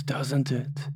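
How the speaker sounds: whispered tag question. Acted. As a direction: let the question fade into a whisper.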